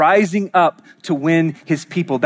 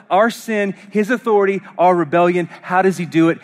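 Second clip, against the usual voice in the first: higher, driving sermon delivery; impassioned preaching; 6 semitones higher than usual. Real, not acted. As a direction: press each question home, pitch raised. Preaches with rising urgency.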